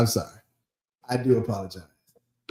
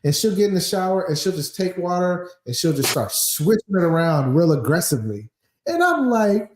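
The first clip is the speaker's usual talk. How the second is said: ordinary talk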